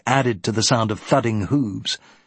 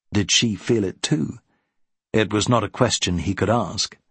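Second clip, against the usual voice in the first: ordinary delivery, nothing marked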